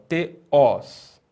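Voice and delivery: teós in two parts, te then stressed ós; final-syllable stress. Shown as a demonstration. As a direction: put the weight on the last syllable of teós. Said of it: "E o acento na última sílaba".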